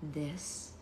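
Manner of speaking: hushed, soft reveal; secretive. A narrator's reading. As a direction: say it very quietly, showing a secret.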